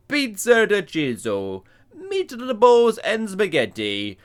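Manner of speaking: slow, hammed-up Italian accent reading the signs. Host reading fake Italian signs aloud, in a mock Italian accent. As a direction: drawl the menu like an Italian waiter, in an Italian accent. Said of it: "I'm not sure I'm allowed to do this accent".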